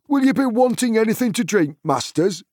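high-pitched, eager little servant; hobbit servant. A narrator's reading. Hobbit servant offering the guests drinks, high and eager. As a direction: pipe up politely to the guests.

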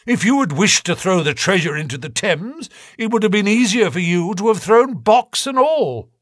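high, hard questioning voice; cold probing inspector. A narrator's reading. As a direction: press him like a detective. Inspector presses the convict.

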